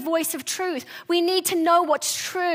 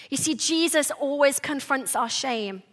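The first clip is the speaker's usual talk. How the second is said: ordinary talk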